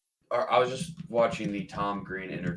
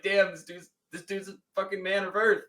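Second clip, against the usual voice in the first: awed disbelief; 9 semitones higher than usual. Retold or imitated. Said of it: "I was like".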